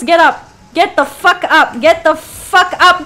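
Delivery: insistent, pushing harder each time; urgent command. Real, not acted. Insistent, pressing. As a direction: player urging the stuck character up. Urging character to move.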